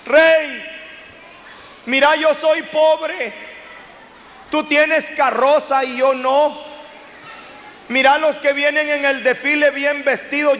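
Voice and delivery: high, pleading beggar's cry to the king; poor man on the corner calling to the king; beggar shouting at the king. Retold or imitated. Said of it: "se pone a gritar".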